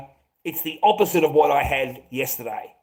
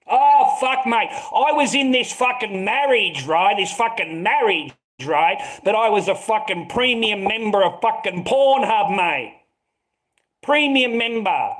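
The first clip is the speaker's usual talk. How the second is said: fired-up confession; 6 semitones higher, 3 dB louder than usual. Acted. Heated confession about marriage.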